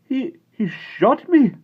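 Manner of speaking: high, slow, stunned; shocked voice. A narrator's reading. Patrick in shock after being shot. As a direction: say it in disbelief. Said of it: "in a shocked voice".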